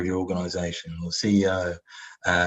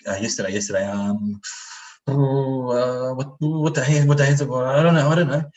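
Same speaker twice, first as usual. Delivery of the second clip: high, loud flustered babbling; a panicked mind going blank, higher and louder; 5 semitones higher, 5 dB louder than usual. Retold or imitated. Flustered employee panicking under the boss's questions. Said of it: "You'd be like".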